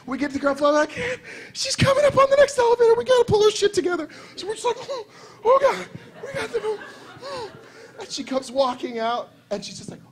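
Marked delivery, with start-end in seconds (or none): 1.5-4.1 very high, hurried squeal; retold frantic squeak through laughter; laughing panic. Retold panic while laughing hard. 4.8-6.8 high, hurried squeak through laughing; imitating someone gasping with laughter; retold panic, choked by laughs; helpless laughter.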